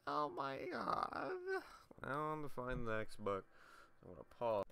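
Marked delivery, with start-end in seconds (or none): 0.1-1.6 high, sugary sarcastic gushing; mock gushing voice. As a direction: ham up a fawning thank-you. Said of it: "It's a copy of".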